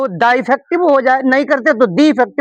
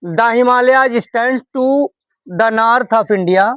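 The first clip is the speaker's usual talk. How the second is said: slow, word-by-word model sentence; slow dictation; much slower than usual. Shown as a demonstration. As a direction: read each word out slowly for the class. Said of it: "हम उच्चारण दा करेंगे".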